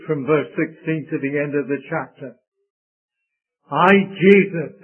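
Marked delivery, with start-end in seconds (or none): 3.7-4.8 "I" hit louder and drawn out slowly; emphasis on I. Shown as a demonstration. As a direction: lean hard on the word "I", slowly. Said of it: "I'd like to emphasize the first one".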